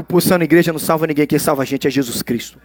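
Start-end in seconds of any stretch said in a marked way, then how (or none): none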